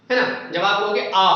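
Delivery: ordinary talk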